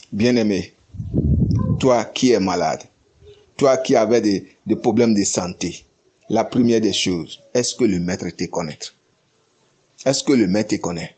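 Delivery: low, soft, gentle address; tender appeal. Acted. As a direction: speak quietly to the one who is sick. Pastor turns softly to the sick. (appel doux, voix basse et plus douce)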